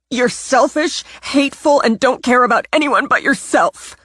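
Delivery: high, loud, spitting contempt at her sister; furious accusation. Acted. Natalie lashing out at her sister, furious and high.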